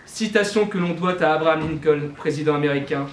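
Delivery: ordinary talk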